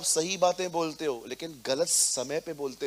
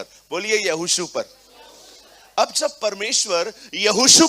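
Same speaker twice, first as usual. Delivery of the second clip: loud, raised preacher's voice; full-force preaching; 3 semitones higher, 8 dB louder than usual. Acted. Pastor preaches Joshua's story to the congregation, loud. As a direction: drive the Bible story home to the hall.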